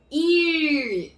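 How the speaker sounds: "ir" with a long growled R; drawn-out final R. Shown as a demonstration. Teacher joining I and R, drawn-out R. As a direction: hold the R at the end of "ir". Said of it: "Se fosse o I".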